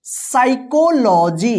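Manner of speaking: साइकोलॉजी drawn out slowly, starting straight on "sai"; silent P. Shown as a demonstration. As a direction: say साइकोलॉजी syllable by syllable, no P sound. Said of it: "क्या पढ़ेंगे".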